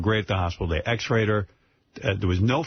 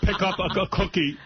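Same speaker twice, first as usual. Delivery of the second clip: stern father; 10 semitones higher than usual. Retold or imitated. Daughter imitating her upset father, higher and scolding. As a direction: scold like a fussy old father. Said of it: "He goes".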